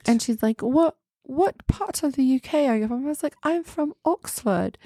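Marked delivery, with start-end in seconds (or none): 0.6-3.0 higher mock-British question; British impression, pitched up; imitated British accent. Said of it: "British accent conversation". 3.4-4.8 high mock-Oxford British; pitched-up British impression; put-on British accent. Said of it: "I just kept talking like that".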